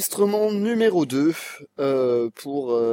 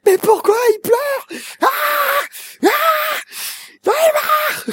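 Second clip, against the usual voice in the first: mock frustrated shout. Dubber loses it in mock frustration.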